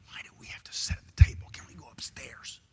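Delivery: hushed whisper at the table; whispered complaint. Retold or imitated. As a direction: whisper a complaint so guests won't hear.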